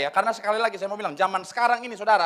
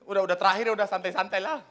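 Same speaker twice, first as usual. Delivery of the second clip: normal talking voice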